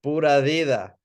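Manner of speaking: upbeat Costa Rican greeting; cheerful reply. Shown as a demonstration. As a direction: answer "Pura vida" brightly. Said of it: "guess what I'm gonna say".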